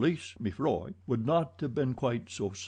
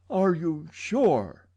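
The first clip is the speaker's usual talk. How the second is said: anxious; 7 semitones higher, 5 dB louder, much slower than usual. A narrator's reading. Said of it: "pressing his hand to his heart".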